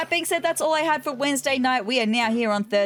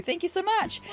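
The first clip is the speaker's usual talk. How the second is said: high, soft little snowman thanks; snow boy voice; 9 semitones higher, 4 dB quieter than usual. Acted. Grateful snowman thanking the player, high and soft. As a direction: thank them sweetly, up high and softer.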